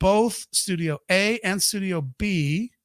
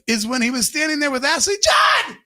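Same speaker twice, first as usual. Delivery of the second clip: ordinary talk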